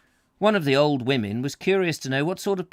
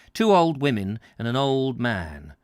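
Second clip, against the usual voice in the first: plain reading voice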